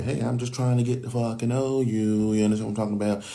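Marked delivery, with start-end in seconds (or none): 0.0-2.3 light, friendly, well-meaning tone; friendly in his head. Retold or imitated. Guy imagining his own harmless approach, said friendly. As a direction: sound harmless and eager to meet her. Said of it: "we're just like".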